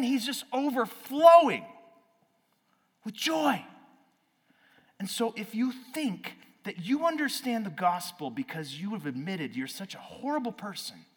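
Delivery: high, loud preaching at full force; fired-up preaching. Acted. Preacher to congregation, full-force loud high preaching. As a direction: preach it out with rising fervor.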